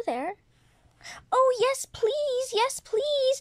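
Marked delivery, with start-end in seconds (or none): 1.3-3.4 excited begging. Acted. Toy begs to join giveaway.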